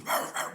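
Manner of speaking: loud barking sounds; imitated barking. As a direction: bark like the pit bull. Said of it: "he was barking".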